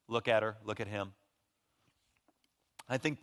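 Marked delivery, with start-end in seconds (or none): none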